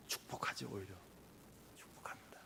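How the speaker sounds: whispered, almost unvoiced; soft sincere whisper; hushed. Real, not acted. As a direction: drop to a reverent whisper. Sermon point on blessing.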